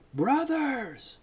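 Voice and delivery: high joyful call to the crowd; triumphant cry. A narrator's reading. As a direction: ring out with triumph. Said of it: "the triumphant cry resounded".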